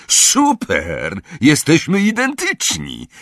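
high-pitched gleeful exclamation; excited cry. A narrator's reading. Twins seeing they now look the same, excited cry. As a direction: cry it out high in mock amazement. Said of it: "jednocześnie krzyknęli".

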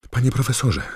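high, loud urgent call; Harry calling out. A narrator's reading. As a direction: call out to grab the headmaster's attention. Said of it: "zawołał Harry". (głośne, naglące zawołanie)

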